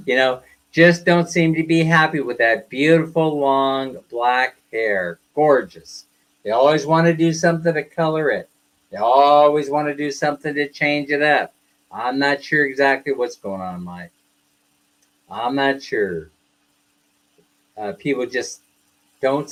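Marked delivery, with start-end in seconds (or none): none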